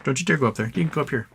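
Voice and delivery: usual speaking voice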